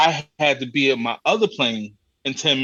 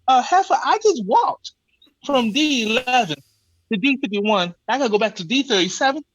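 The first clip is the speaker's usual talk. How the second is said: exasperated rant; 9 semitones higher than usual. Retold or imitated. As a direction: vent at the agent in a high exasperated rant. Ranting at the gate agent.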